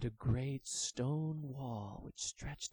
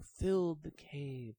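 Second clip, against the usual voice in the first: plain reading voice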